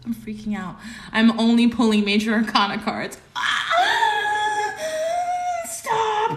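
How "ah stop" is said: thrilled shriek; excited scream. Real, not acted. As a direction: squeal out in disbelief. Card reveal overwhelms her.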